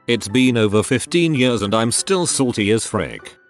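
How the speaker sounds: ordinary delivery, nothing marked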